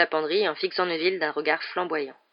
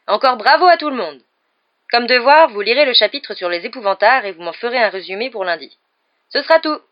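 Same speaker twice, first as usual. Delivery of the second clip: loud, raised teacher's voice; addressing a class; 5 semitones higher, 8 dB louder than usual. A narrator's reading. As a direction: announce it to the whole class. (annonce à la classe, voix forte)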